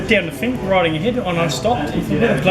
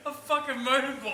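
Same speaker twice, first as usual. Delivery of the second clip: much softer, squeezed out between laughs; fading into laughter at the punchline; laughing; 7 semitones higher, 12 dB quieter than usual. Real, not acted. Still laughing at the story.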